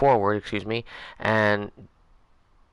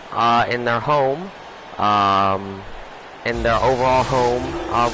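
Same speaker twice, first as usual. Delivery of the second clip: sports announcer. Acted. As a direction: open the broadcast like a TV commentator. Switches into broadcast commentator mode.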